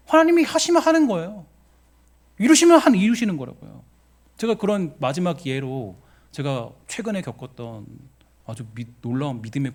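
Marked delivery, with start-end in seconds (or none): 0.1-3.8 loud, high declaration of conviction; emphatic preaching. Real, not acted. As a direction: drive the point home with a raised voice. 확신을 힘주어 선포. (확신에 차서 높게 외치는 설교조)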